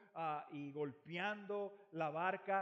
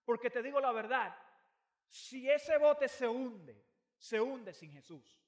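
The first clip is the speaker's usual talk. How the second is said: loud, driving sermon voice; fervent preaching; 6 semitones higher, 10 dB louder than usual. Acted. Pastor drives home the point to the congregation, preaching loudly. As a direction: preach it with full force.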